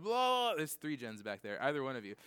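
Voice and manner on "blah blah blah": mock outburst. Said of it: "just start going".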